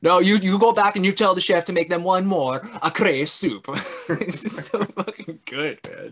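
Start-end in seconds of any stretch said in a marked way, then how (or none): 0.0-3.7 louder, higher mock-Scottish ordering; Scottish accent. Diner sending an order back to the chef, insistent Scottish accent. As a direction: put on an insistent Scottish dad. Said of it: "he's got this really thick Scottish accent".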